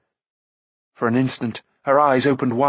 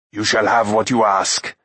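firm, ringing warrior's declaration; harsh and cold; 4 dB louder than usual. A narrator's reading. Gwydion yielding to Akron, harsh and cold. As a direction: declare it coldly and forcefully. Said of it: "The warrior's voice rang harsh and cold".

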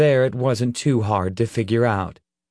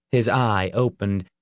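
usual speaking voice

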